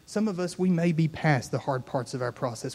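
loud, rising altar-call preaching over music; full-force preaching. Acted. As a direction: lift the room and call them forward.

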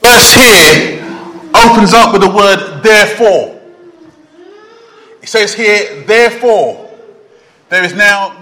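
loud, ringing pulpit delivery; preaching at full force. Acted. Preacher booming the opening verse to the congregation. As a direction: proclaim each word to the back rows. Preacher opens the passage loudly.

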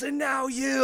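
high, loud mock exasperation; super emotional. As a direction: imitate an upset man turning on you. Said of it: "he's getting super emotional".

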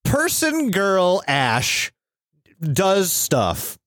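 put-on slow, dumbed-down toddler talk; explaining to a child. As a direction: spell it out slowly for a small kid. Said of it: "I will explain it like you're five".

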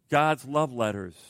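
usual speaking voice

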